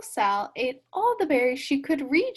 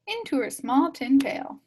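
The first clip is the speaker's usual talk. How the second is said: ordinary delivery, nothing marked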